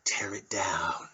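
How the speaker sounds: slow, menacing whisper; whispered Etonian. Retold or imitated. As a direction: whisper it like a scheming toff. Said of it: "these fucking Etonians".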